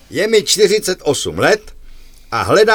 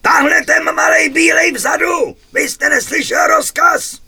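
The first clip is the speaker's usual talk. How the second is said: officer roaring again; 12 semitones higher than usual. Acted. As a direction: roar at the little one in the back. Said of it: "znova zařve".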